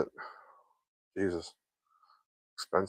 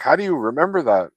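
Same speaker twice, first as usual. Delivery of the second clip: lightly voiced mom, pitched higher; a hint of an incredulous mother; mom's disbelief; 5 semitones higher, 4 dB louder than usual. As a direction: mimic mom's amazed question, a bit higher. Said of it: "She's like".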